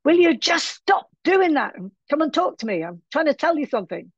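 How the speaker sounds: shouting at a partner who is too busy to listen; shouty. Retold or imitated. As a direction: snap it out like a neglected partner. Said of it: "get shouty and say".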